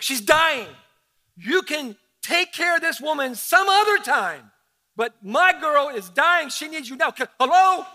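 loud, high, pleading father; a frantic father whose girl is dying; desperate father. Retold or imitated. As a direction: beg Jesus to hurry, rising urgency. Voicing frantic father Jairus.

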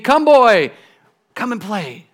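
high, excited call, softening on the second phrase; overjoyed tree. Acted. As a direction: call the boy to play, overcome with happiness. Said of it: "so happy she could hardly speak".

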